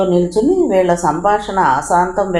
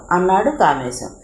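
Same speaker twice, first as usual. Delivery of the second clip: usual speaking voice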